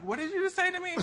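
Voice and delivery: high, indignant what did you say; incredulous. Retold or imitated. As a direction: jump way up in disbelief. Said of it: "and I'm like".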